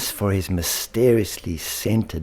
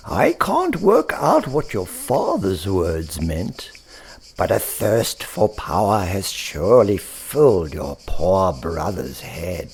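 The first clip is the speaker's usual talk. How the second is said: put-on old rabbit. Alexander the rabbit speaks.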